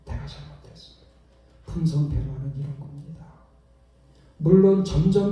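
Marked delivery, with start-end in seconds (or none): 0.0-0.9 soft, low, sincere sorry to his wife; tender apology. Retold or imitated. Husband apologizing to his wife after the fight, tender and soft. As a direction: fade to near whisper as you apologize.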